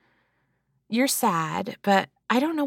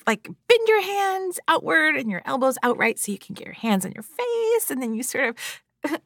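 normal talking voice

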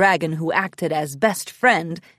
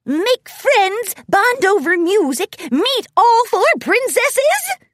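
high, loud, giddy pony voice; excited guessing; 12 semitones higher, 6 dB louder, slower than usual. A narrator's reading. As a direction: gush out the possibilities excitedly. Excited festival-goer answers in story.

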